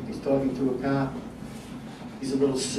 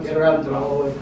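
ordinary delivery

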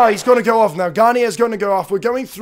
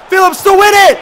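loud, high urgent call; excited build-up; 8 semitones higher, 9 dB louder, faster than usual. Real, not acted. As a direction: urge him to score. Last-minute chance.

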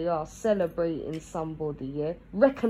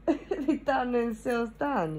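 slow, higher, laughing through it; 5 semitones higher, slower than usual. Shown as a demonstration. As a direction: drag it out while laughing at them. Repeats phrase, laughing slowly.

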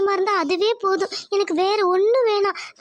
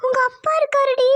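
startled; 5 semitones higher than usual. Acted. Mother startled to find her daughter at the door, alarmed. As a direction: caught off guard, sharp alarmed surprise.

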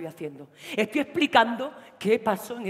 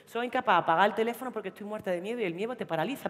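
ordinary delivery

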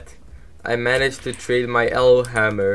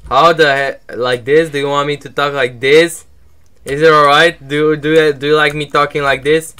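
much louder, higher, almost shouting; talking louder on request; 4 semitones higher, 9 dB louder than usual. Pretend. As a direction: crank up the volume as a joke. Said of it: "talk louder".

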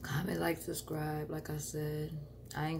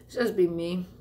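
ordinary talk, nothing marked

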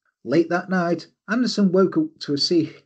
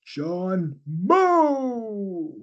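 ring announcer; 6 semitones higher, slower than usual. Pretend. Host introduces suitor John Moe like a wrestler, booming. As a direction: announce him like a prizefighter.

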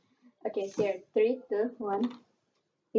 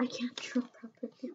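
whispering; 10 dB quieter than usual. Real, not acted. Hushed reply while drawing.

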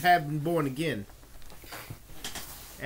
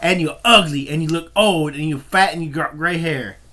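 much louder, hurling each insult; yelled insults; 8 dB louder than usual. Real, not acted. Ranting at Dusty, loud jeering insults. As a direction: bark the insults at him.

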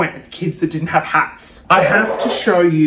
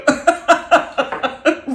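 higher breathy laugh; airy laugh; 8 semitones higher than usual. Real, not acted. Vlogger laughing at her gag, airy laugh.

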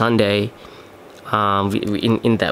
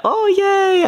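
a sudden, much higher, squealy "yay"; overjoyed squeak of a yay; excited squeal; 3 dB louder than usual. Retold or imitated. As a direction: squeal with delight at getting paid. Excited quote about getting paid.

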